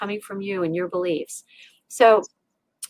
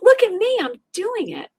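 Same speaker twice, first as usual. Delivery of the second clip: boastful; 9 semitones higher, 3 dB louder than usual. Retold or imitated. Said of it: "You're like".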